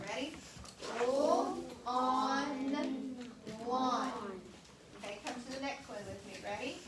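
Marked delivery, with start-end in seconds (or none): 1.0-4.1 teacher sounding out a word for the class. Shown as a demonstration. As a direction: spell out "Pull on lawn" slowly, each sound apart. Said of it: "Do your fingers in the air".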